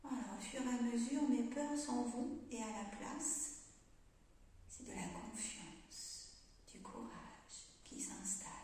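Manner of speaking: slow, fading to a whisper; guided meditation. Acted. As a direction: breathe it out softly, slowly. Guides breathing exercise calmly.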